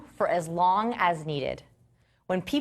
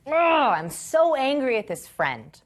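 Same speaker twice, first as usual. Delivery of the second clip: higher, slow mock-angry complaint; mock angry partner; 6 semitones higher, 3 dB louder, slower than usual. Partner comes home upset about a friend, mock angry. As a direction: play the angry partner, dragging it out. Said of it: "they're like".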